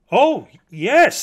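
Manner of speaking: high, loud, effusive agreement; emphatic assurance. Acted. Mockingbird emphatically confirms praise.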